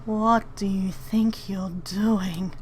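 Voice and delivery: a hissed, voiceless whisper; menacing whispered challenge. Acted. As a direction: breathe the question out with no voice at all. Yes, what do you think you're doing?.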